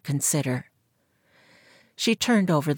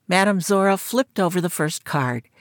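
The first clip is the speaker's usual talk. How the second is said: ordinary delivery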